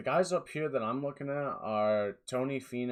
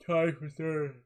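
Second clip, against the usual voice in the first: said through a yawn; 6 semitones higher, 4 dB louder, slower than usual. Real, not acted. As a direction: yawn the words out. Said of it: "I'm so sorry for yawning".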